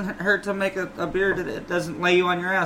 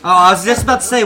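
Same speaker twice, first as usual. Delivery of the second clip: normal talking voice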